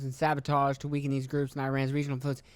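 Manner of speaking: normal talking voice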